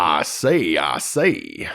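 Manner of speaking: low, drawn-out cartoon rooster bluster; deep Southern rooster catchphrase; Foghorn Leghorn. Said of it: "Foghorn Leghorn".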